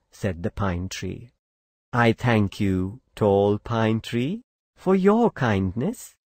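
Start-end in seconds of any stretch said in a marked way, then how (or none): 1.9-6.2 slow, rising thanks; grateful little bird. Acted. Little bird thanking the tall pine, slow and sweet.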